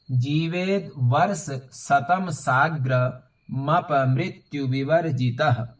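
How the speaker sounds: measured, unsung Sanskrit recitation; slow full read-through. Shown as a demonstration. Teacher reads the whole verse through for learners, slow recitation. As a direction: read the verse slowly with long vowels. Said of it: "इसे एक बार पूरा पढ़ लेते हैं".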